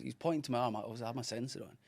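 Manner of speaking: ordinary talk, nothing marked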